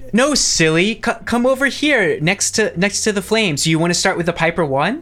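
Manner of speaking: high, bright playful invitation; flirty woman. Acted. Woman coaxing the player to the flames, high flirty voice. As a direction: coax him toward the flames.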